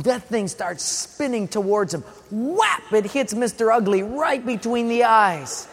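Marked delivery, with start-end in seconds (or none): 2.3-2.9 sudden high-pitched whap; impact sound. Retold or imitated. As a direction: imitate a stone smacking its target. Imitates the stone hitting.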